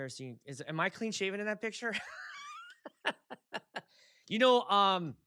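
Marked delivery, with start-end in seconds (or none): 2.0-3.8 high laugh. Real, not acted. Laughs at own photo.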